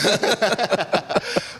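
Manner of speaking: high loud real burst of laughter; amused laugh. Real, not acted. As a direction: laugh at the teasing. Laughs at entitlement remark.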